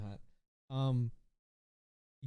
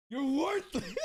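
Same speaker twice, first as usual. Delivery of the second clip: high, loud mocking rant voice; low tier god rant; 10 semitones higher, 4 dB louder, slower than usual. Chat asks [name] for the low tier god speech, so he delivers a mocking rant. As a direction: spit it like the streamer meme. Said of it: "give us your best low-tier god speech".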